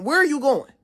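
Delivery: high-pitched indignant yell, retold. Student retelling how she yelled at staff member, high retold yell. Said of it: "Now I'm yelling at him".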